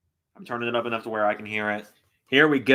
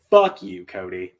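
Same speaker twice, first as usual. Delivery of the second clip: sudden loud, higher curse; angry shout; 4 semitones higher, 6 dB louder, slower than usual. Real, not acted. Streamer yelling at the wrestler on screen, angry shout. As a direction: spit it out at full force.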